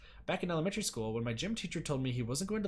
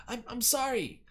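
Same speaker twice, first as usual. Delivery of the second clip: tearful apology; 10 semitones higher, 4 dB louder, slower than usual. Acted. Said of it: "choked by tears and ragged".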